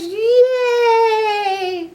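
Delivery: very high, loud squeal of "Yay"; excited cheer. Acted. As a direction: burst out with joy. Piggy cheering.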